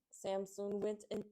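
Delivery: usual speaking voice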